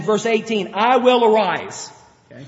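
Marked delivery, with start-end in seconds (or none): 0.7-1.9 louder and slow, stress on I; declared with force. Shown as a demonstration. Preacher announcing the son's decision, emphatic. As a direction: proclaim it like a resolve. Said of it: "Here's the action".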